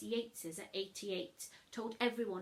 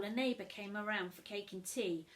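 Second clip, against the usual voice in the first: ordinary delivery, nothing marked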